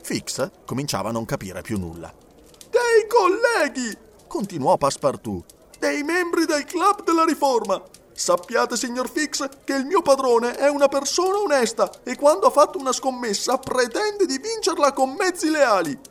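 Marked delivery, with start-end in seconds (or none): 2.7-4.0 very high, loud, slow exclamation; heated outcry. A narrator's reading. Servant still raging at the gentlemen, heated outcry. 5.8-16.0 high-pitched, impassioned character voice; indignant defence. A narrator's reading. Servant telling detective his master is honest, indignant.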